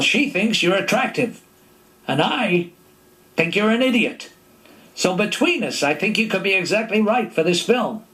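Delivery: hint of a dry American director; Gene Kelly. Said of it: "he said".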